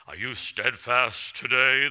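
voice of the Lord. Retold or imitated. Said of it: "the Lord would say".

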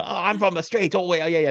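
high, hammed-up Cockney; mock Cockney babble; overdone Cockney accent. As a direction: imitate someone trying too hard at Cockney. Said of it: "she's really trying with".

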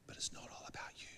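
hushed, unvoiced-sounding whisper; whispered aside. Real, not acted. Preacher gently correcting the congregation, whispered. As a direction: drop right down to a whisper.

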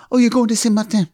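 mock affected French accent. Husband mimicking people who correct him, affected French accent. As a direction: imitate people overdoing a French Saint Martin. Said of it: "to combat people who every time I say Saint Martin".

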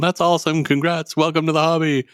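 forced cheerful congrats; high fake cheer through smiles; fake friendly. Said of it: "having to be all smiles and handshakes".

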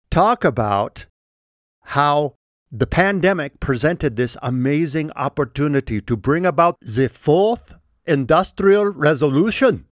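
usual speaking voice

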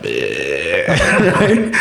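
long, low, droning groan; drugged-out bleh. Retold or imitated. Imitating a friend dosed with morphine, drugged-out. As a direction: act out a morphine stupor. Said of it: "give him some morphine".

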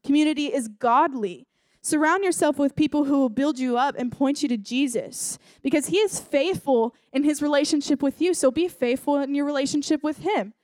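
ordinary talk, nothing marked

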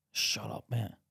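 ordinary delivery

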